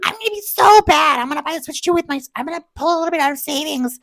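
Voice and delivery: very high, fast mischievous voice; giddy naughty excitement. Retold or imitated. To Jordan coming home, giddy mischievous confession. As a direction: confess the treat like a giddy kid. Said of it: "and I'm like".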